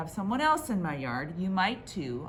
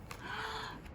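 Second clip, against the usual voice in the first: sharp unvoiced gasp; 14 semitones higher, 12 dB quieter than usual. Dramatic turn in story.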